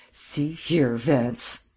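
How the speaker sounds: understated growl of protest; a touch of gruff irritation; mildly growled rebuke; growled warning. A narrator's reading. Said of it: "Markham growled".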